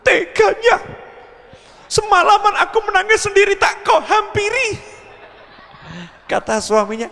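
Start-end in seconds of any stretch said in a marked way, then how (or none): 0.0-4.8 very high, wailing wife voice; whiny crying wife. Acted. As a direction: wail it like a wife who cried all night.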